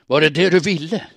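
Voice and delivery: enraged shout at the woman; furious shout. Acted. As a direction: scream it at her in fury. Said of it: "skrek han".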